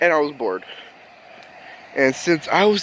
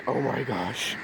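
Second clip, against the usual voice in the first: much softer, lower "oh my gosh"; hushed dread; 4 semitones lower, 10 dB quieter than usual. Retold or imitated. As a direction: let it sink in under the breath.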